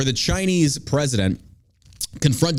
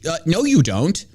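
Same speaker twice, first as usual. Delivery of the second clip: ordinary talk, nothing marked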